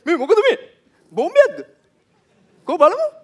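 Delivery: high excited curious onlooker voice, laughing; a gawker rushing up to a bomb scene; nosy bystander. Retold or imitated. Bystander at a crash asks excitedly what's going on.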